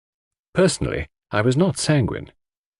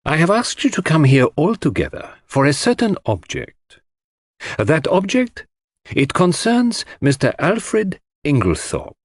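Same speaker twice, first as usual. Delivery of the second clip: Poirot addressing the room, higher and formal; lecturing; 6 semitones higher than usual. Acted. Poirot announcing his object to the assembled household, formally. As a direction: deliver it like a celebrity lecturer. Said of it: "as though he were a celebrity about to deliver a lecture".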